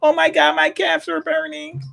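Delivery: high, wailing mock dad voice; hammed-up panicking dad; whiny dad. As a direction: wail it like a dramatic, overwhelmed father. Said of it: "they dad is like".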